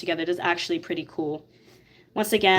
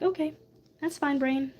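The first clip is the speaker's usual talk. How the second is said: high resigned; 8 semitones higher, 4 dB quieter than usual. Retold or imitated. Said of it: "I'm like".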